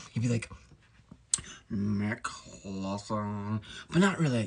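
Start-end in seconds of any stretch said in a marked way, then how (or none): 1.7-3.6 long, drawn-out disapproving name; Snape. Acted. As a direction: stretch the surname out like an unhurried teacher. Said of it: "And then that Snape over there".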